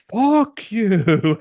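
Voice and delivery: high, loud mock snap down the phone; angry fuck you. Heroine telling the genie off down the phone, high loud mock snap. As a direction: slam it back at him in mock anger. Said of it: "picks up and goes".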